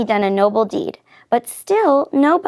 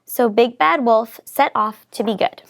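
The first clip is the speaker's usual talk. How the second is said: ordinary delivery, nothing marked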